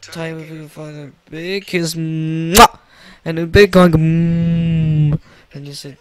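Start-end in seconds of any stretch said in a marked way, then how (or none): 2.0-2.8 drawn-out hum into a smacking kiss; "mwah" kiss noise; big kiss sound. Acted. 3.9-5.2 long squeezing "mmm"; drawn-out affectionate hum; big hug hum. Acted.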